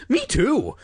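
high-pitched, frightened agreement; fearful. A narrator's reading. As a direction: pipe up nervously, pitch jumping high. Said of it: "unable to keep the fear".

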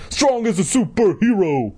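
high-pitched boastful cheer; bellowing. A narrator's reading. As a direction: proclaim it like a triumphant superhero. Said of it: "he bellowed".

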